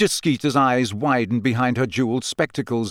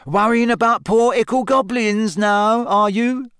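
high, louder teasing question; mock-pitying needling, pitched up; 10 semitones higher, 4 dB louder than usual. A narrator's reading. Ron teasing Hermione about her concern for goblins, mocking.

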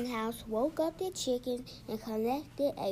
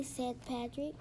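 ordinary delivery, nothing marked

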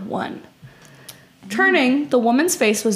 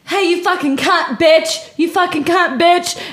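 higher, louder mock cursing; mock insults; 7 semitones higher, 4 dB louder than usual. Imitating the woman cursing at the narrator, mock angry. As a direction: hurl the insults in a put-on rant. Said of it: "imagine if she's like".

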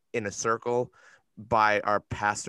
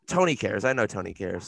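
ordinary delivery

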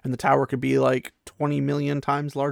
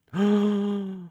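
mock gasp; 8 semitones higher than usual. Mock gasp at Egwene's childishness.

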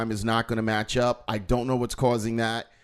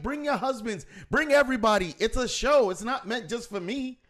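exasperated high voice; 9 semitones higher than usual. Retold or imitated. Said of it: "ladies act like".

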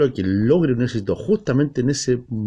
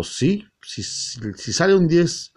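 high, slow knowing drawl; game master voice; 7 semitones higher, slower than usual. Retold or imitated. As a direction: play the smug game master granting it. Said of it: "y ahí me dijo el tipo". (voz de máster socarrona y lenta)

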